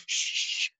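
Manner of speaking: long hissed "shh"; mimicking people hushing passers-by; imitated shushing. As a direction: shush a noisy crowd. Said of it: "everyone's going".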